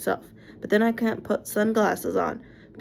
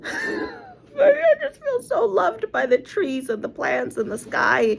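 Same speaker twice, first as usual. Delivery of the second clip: laughing through tears; 13 semitones higher, slower than usual. Real, not acted. Tearful laugh about feeling loved.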